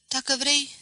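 ordinary delivery

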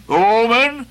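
mocking outburst. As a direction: throw Omen? back with scorn. Ahab scorns the word.

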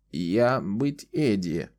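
put-on slow creaky old woman's voice. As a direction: creak the words out slowly. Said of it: "ответила она скрипучим голосом".

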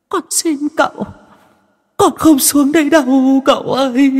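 very high falsetto, crying plea; begging ghost. A narrator's reading. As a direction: ghost clinging to his legs, begging. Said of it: "chồm lấy chân cậu Tẩy mà lạy".

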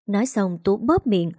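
ordinary delivery, nothing marked

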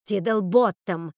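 "Тиддлботтом" said loud and slowly; emphatic correction. A narrator's reading. Sister correcting a wrong name, "Тиддлботтом" slow and emphatic.

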